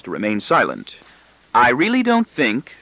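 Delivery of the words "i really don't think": mild, slow teasing reproach, a little higher; amiable chiding. A narrator's reading. Wife amiably chiding her husband, mild slow reproach. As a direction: chide him gently, half playing. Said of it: "chastises my wife amiably".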